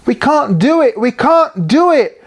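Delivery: high desperate wail. A narrator's reading. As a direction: wail in despair. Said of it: "wailed Katikit".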